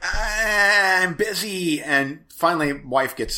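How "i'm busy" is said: slow, drawn-out I'm busy; a lightly mocking, drawn-out refusal; curt brush-off. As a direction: stretch it out, clearly brushing him off. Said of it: "And I'm like".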